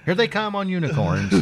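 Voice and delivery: high, excited announcing; mock excited announcement. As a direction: call it out like a thrilling reveal. Mock trailer announcement, unicorns.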